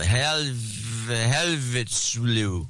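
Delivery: garbled, very slow name reading; slowly mangled name. Shown as a demonstration. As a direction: drag out "Helvetslu" syllable by syllable, very slow. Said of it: "It sounds like he had a stroke".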